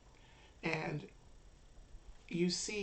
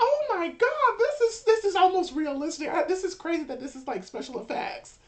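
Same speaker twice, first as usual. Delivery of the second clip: very high, loud, hammed-up amazement; astonished onlooker; 13 semitones higher, 10 dB louder than usual. Game master voices crowd taking blood for effects, shrill excited awe. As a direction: squeal in mock awe, easing down by the end.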